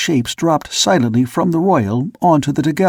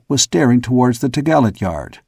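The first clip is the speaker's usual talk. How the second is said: plain reading voice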